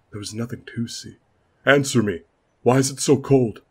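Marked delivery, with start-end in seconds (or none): 1.6-3.6 loud, high and frightened; panicked shout. Acted. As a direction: yell into the dark in growing fear. Lord panics in the cold.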